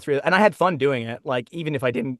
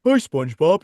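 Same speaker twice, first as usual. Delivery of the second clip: dopey cartoon starfish greeting; a goofy, lightly done Patrick Star hello; 5 semitones higher, 6 dB louder than usual. Shown as a demonstration. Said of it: "bad impersonation of Patrick Star".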